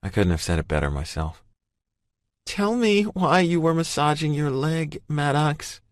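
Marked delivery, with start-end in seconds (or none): 2.4-5.8 raised-pitch woman, pleading; imploring. A narrator's reading. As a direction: gently beg for the truth. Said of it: "she implores".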